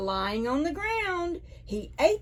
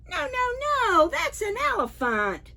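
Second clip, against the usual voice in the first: put-on much higher voice, a child monkey saying no; little monkey protesting; 13 semitones higher than usual. Storyteller to children, as the monkey, high protest.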